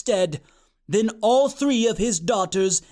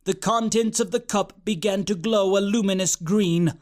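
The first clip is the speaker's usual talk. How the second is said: usual speaking voice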